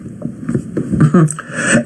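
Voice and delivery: quiet airy chuckle under the breath; hushed, scornful breath of a laugh; soft breathy laugh. Real, not acted. Laughs at own mockery.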